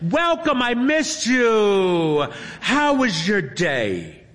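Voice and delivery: put-on high, slow, sugary girl voice; sweet streamer voice. A narrator's reading. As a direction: coo at a favourite fan. Streamer girl greets fan.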